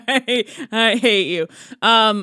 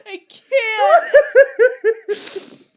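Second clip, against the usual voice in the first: faint, voiceless words through laughter; helpless laughter; 11 semitones higher than usual. Real, not acted. Co-host with a laughing tummy ache, helplessly spent. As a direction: squeeze it out with no voice left. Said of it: "I have a laughing tummy ache".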